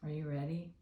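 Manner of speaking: ordinary delivery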